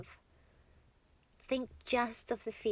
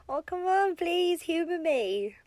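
playful pleading; 8 semitones higher, 8 dB louder than usual. Acted. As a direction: coax them with high, loud pleading. Coaxing a partner into hugging.

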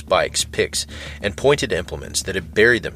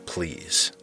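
a breathless, desperate whispered please; 7 dB quieter than usual. A narrator's reading. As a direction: barely voice it, begging under your breath. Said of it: "they'd hear him utter a desperate".

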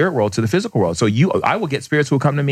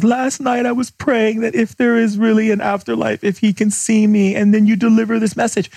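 imitating an overwhelmed mother; mother's confession; 9 semitones higher than usual. The mother's tearful confession, retold.

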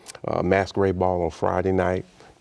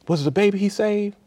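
put-on much higher, gasping question of an old woman; breathless old woman; 14 semitones higher than usual. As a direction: gasp it out in disbelief. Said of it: "She said, gasps".

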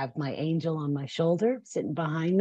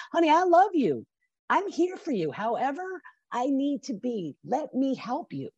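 higher, gentle and caring; a woman gently reaching out to a friend in trouble, higher and tender; 7 semitones higher than usual. Pretend. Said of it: "as women as we are and say".

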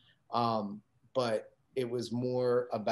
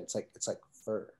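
purring fur; 4 semitones lower, 8 dB quieter than usual. Retold or imitated. Club owner correcting the bartender on his club's name, mock sultry purr. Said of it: "touched his shoulder and the whole thing".

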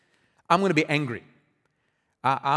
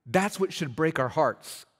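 ordinary delivery

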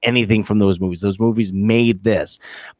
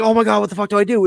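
panicked; 9 semitones higher, faster than usual. Retold or imitated. Retelling a panicking character.

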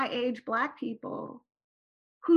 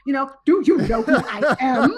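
laughing mock entitlement; 8 dB louder than usual.

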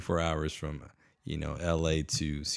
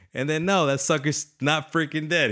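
excited outburst; 9 semitones higher than usual. Real, not acted. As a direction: burst out with disbelieving glee. Excited about the surprise twist.

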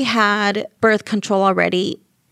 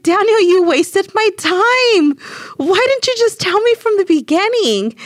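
angry scolding; 11 semitones higher, 4 dB louder than usual. Retold or imitated. Wife scolding her husband for wasting her time, high and angry. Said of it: "And I was so mad".

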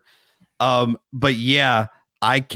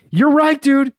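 excited laughing; 12 semitones higher, 6 dB louder than usual. Real, not acted. Laughing disbelief at the movie.

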